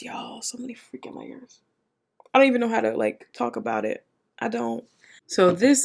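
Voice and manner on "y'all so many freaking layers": very soft, voiceless repeat; hushed, barely-there whisper; awed whisper. Real, not acted. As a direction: whisper it, as if overwhelmed. Repeats it, overwhelmed by episode.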